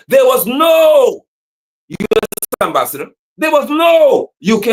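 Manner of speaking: indignant preaching shout. Real, not acted. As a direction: hammer the absence. Rising indignation.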